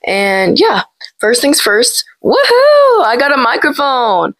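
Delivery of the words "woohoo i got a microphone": high, whooping little cheer; excited cheer. Real, not acted. Vlogger celebrates getting a microphone, excited cheer.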